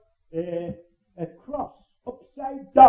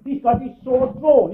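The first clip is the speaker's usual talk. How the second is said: ordinary talk, nothing marked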